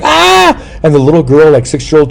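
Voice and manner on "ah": high mock scream on the ride; retold scream. Man on Disney ride, hammed-up high scream. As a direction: shriek like you're terrified of the drop. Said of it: "I was like".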